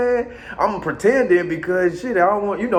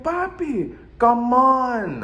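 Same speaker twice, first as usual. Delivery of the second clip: woman's voice; 9 semitones higher, much slower than usual. Retold or imitated. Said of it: "she like".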